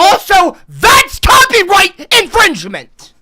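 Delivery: high, loud, drawn-out objection; outraged shout. Real, not acted. Streamer accusing Joe over his video, outraged shout. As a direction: yell the accusation slowly and at full volume.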